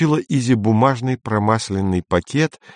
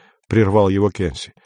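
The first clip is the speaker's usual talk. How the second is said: plain reading voice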